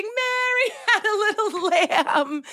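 high, slow rhyme through laughter; mock laughing. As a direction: laugh your way through the line. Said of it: "Try it like you're laughing".

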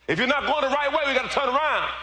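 high, driving exhortation; preaching shout. Real, not acted. Preacher urging neighbors to turn around, high preaching shout. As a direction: push it out high to rally them.

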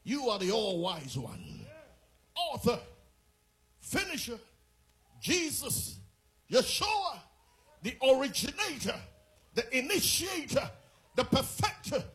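loud, high, chanted praise; preacher at full force shouting praise; ecstatic preaching. Acted. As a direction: cry out the names of God at full force. Prayer climbs to a peak.

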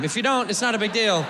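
ordinary delivery

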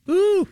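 very high mock moo; squeaky-high comic animal call; high-pitched moo. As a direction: imitate a steer mooing way up high. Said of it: "makes their mooing a little higher".

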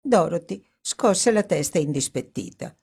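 ordinary delivery, nothing marked

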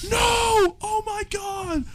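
imitated screaming. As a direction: shriek it high, imitating a horrified friend. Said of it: "he was screaming in the middle of a Cane's".

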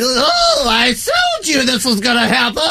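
high squeaky laughing gloat. Retold or imitated. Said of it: "Real squeaky voice".

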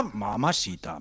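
very low, hushed murmur of pleasure; hammed-up low swoon; awed savouring. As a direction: savour it, deep and soft. Re-enacts first taste of the bite.

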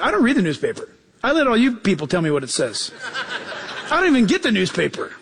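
ordinary talk

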